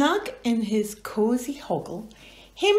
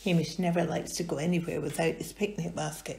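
plain reading voice

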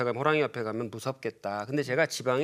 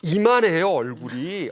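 emphatic insistence; 5 dB louder, slower than usual. Real, not acted. Insisting on tiger's face size.

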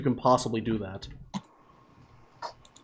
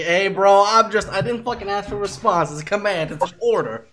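higher, louder retelling of a retort; quoted retort; 7 semitones higher, 4 dB louder than usual. Retold or imitated. Retelling a command given to another player, insistent. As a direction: play back the argument, raised and insistent. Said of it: "So he's like".

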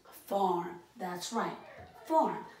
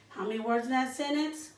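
ordinary talk

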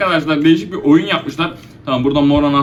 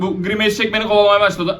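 ordinary talk, nothing marked

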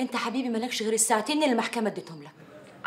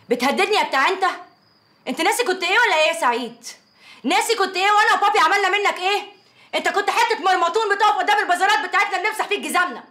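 higher and louder, building fury; angry tirade; 6 semitones higher, 3 dB louder than usual. Acted. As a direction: let him have it, rising anger. Furious row with ex-husband. (غضب متصاعد في خناقة)

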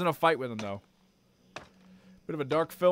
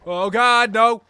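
loud dismayed yell; panicked gamer outburst; alarmed shout; 6 semitones higher, 12 dB louder, slower than usual. Real, not acted. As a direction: cry out in dismay. Alarmed by game event.

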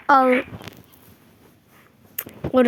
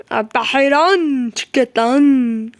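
slow put-on voice; slower than usual.

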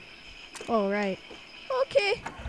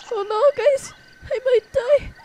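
higher, hammed-up fretting; mock panic; 5 semitones higher, 4 dB louder than usual. Player hears something at night, mock panicking. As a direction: play frantic dread in short bursts. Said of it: "I'm panicking".

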